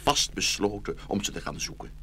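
ordinary talk, nothing marked

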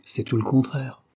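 usual speaking voice

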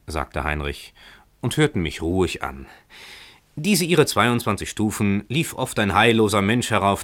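plain reading voice

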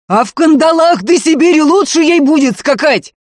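high, loud outburst at breaking point; full-voiced enraged shouting; furious yell. A narrator's reading. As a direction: lose it and scream in anger. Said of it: "заорал, сорвавшись".

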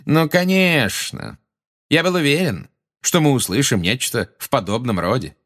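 mock the witness for the audience; caustic. A narrator's reading. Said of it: "на этот раз язвительно улыбаясь".

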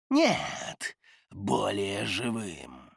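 slow, low rustling correction; sly spirit, drawn out; rustling creature voice. Acted. The dismemberer corrects him.

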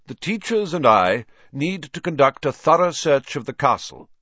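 put-on an old headmaster announcing a search; Dumbledore voice. A narrator's reading. Headmaster addressing the gathered students, in a commanding tone.

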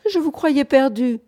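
high, loud exasperated despair; despairing call. A narrator's reading. Bunford calling to the late pair outside, despairing. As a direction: call out as if all hope had gone. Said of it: "d'une voix désespérée".

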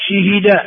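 شهد as shihida, kasra on shin and ha; vowel harmony. Shown as a demonstration. Teacher listing four verb variants, شهد said shihida. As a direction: say شهد as shi-hi-da. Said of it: "أربع لغات".

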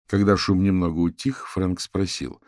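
ordinary delivery, nothing marked

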